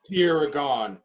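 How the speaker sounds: ordinary delivery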